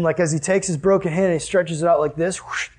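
usual speaking voice

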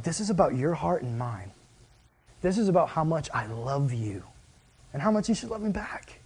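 hushed and tender, a light take on Jesus; gentle Jesus. Retold or imitated. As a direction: speak it like a loving plea, hushed.

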